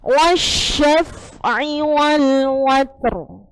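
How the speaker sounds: very slow "Wasyaf'i wal watr", heavy r; slow recitation. Shown as a demonstration. Teacher reciting a Quran verse to the class, very slow. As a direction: draw out each syllable of "Wasyaf'i". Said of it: "roknya dibaca tebal".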